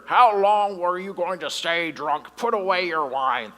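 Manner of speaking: put-on slow, loud, gruff scolding of a drunk; slow grumpy priest demanding she sober up; grumpy old priest. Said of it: "I'm sure that's how he sounded, like a grumpy guy".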